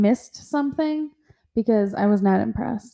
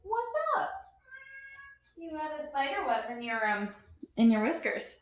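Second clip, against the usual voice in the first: high soft pet voice; high baby talk to cat; 6 semitones higher, 13 dB quieter than usual. Pretend. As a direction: coo softly at the kitty. Talking to the cat.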